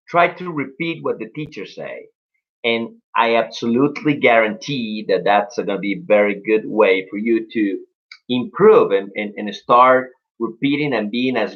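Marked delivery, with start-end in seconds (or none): none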